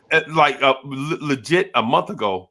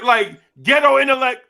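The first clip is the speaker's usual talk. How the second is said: normal talking voice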